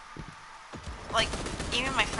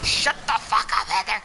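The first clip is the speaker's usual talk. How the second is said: annoyed husband yelling; 6 dB louder than usual. Retold or imitated. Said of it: "and he'd be like".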